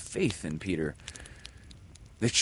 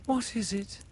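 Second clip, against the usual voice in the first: anxious question. A narrator's reading. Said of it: "anxious about him".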